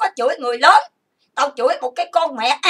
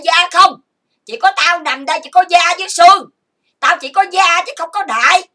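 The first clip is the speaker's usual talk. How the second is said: louder, pitch climbing; heated complaint; 4 semitones higher, 6 dB louder than usual. Real, not acted. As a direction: raise your voice in bitter protest. Angry rant peaks in self-pity.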